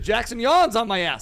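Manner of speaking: 3 call him out with a mock-outraged burst; 1 playful outburst. Real, not acted. 4 host ribbing cohost Jackson for yawning, loud mock outrage.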